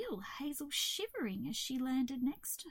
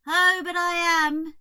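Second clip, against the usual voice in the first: loud, higher moaning protest; glum cockroach; 6 semitones higher, 12 dB louder than usual. A narrator's reading. Cockroach contradicting Hazel's comfort, glum. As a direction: wail it as a miserable cockroach.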